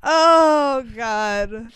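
crying with laughter; laughing cry. Real, not acted. Overwhelmed by laughter.

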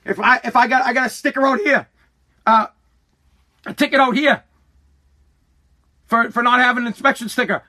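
usual speaking voice

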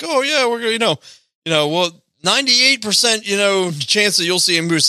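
high, upbeat salesy tour guide voice; mock tour guide. Tour guide promising moose, chirpy sales pitch. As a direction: sell the moose tour with fake cheer. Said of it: "it's like".

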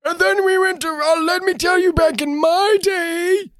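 put-on very high, creaking old-timer voice; exaggerated old geezer, drawn out at the end; eighty-year-old man. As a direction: ramble like a doddering old man. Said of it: "It's like eighty-year-old man just like".

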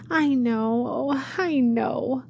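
drawn-out despairing admission; cried. Acted. Much Afraid admits her fault to the shepherd, slow pained cry. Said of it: "cried Much Afraid".

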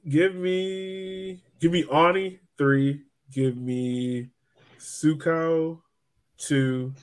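ordinary delivery

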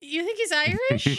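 amused disbelief. Real, not acted. Laughing disbelief at the bit.

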